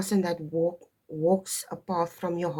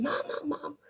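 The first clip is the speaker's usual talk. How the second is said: put-on higher, eager child calling mom; excited child calling; 8 semitones higher, 6 dB quieter than usual. Daughter excitedly calling for her mother. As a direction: play the kid running in, calling mom. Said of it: "and then she".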